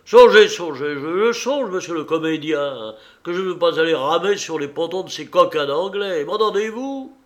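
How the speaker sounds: loud, high, scolding tirade; irate captain berating a passenger; angry retort. A narrator's reading. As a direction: throw the word back at him, then demand obedience. Captain berates the pleading comedian.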